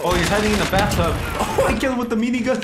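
loud high excited shout; hyped kill callout. Real, not acted.

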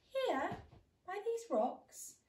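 high, loud little mouse; mouse, sly. Acted. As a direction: squeak it out proudly. Mouse answers fox.

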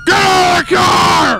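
high, drawn-out yelled command; shouted police order. Acted. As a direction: holler the order slowly, word by word. Officer orders driver out.

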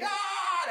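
high mock yell "Ah!"; retold scream. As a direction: yell high as if chasing a bear off. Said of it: "and I screamed".

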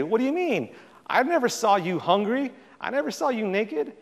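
retelling the goats' shocked denial, high-pitched and incredulous; incredulous protest. Retold or imitated.

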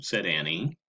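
plain reading voice

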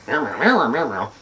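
mock kitty meows; cat meows. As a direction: meow like you want something. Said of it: "But when I go".

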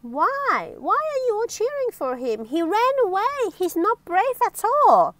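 high, indignant questioning; puzzled protest. Acted. Miss Trouble asking the crowd why they cheer, puzzled protest. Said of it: "Little Miss Trouble looked puzzled".

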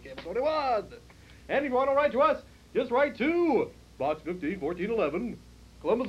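upbeat TV host announcer. Acted. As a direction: read the schedule like a station promo. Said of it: "I'm not gonna do this announcer voice".

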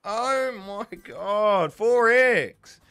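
high, drawn-out outraged disbelief; loud disbelief. Real, not acted. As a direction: cry out slowly, stunned by the result. Disbelief at a bad game result.

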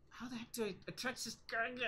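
exasperated high mutter, lightly voiced; puzzled grumble at the gadget; frustrated character voice.

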